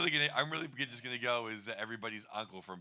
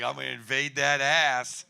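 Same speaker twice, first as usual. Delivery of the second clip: louder slow punchline; 2 semitones higher, 12 dB louder than usual. Retold or imitated. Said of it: "I'm like".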